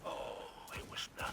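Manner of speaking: modest whisper. Acted. Spider brushes off the Guardian's thanks, whispering. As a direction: wave off the thanks under your breath.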